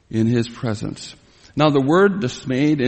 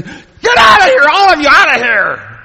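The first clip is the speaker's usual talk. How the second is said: huge anguished scream; 11 dB louder than usual. Retold or imitated. Joseph screaming at his servants to leave, huge anguished scream. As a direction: scream them out of the room, torn up. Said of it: "He screamed".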